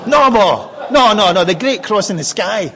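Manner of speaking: loud, high, laughing no; laughing protest. Retold or imitated. The son correcting his ma, loud and laughing.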